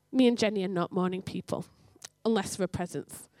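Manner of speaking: ordinary delivery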